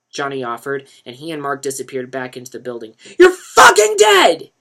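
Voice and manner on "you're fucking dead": much higher, much louder, slow threat; a dealer yelling a death threat; yelled out. A narrator's reading. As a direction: roar it as he's dragged away. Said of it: "Chris R yelled out".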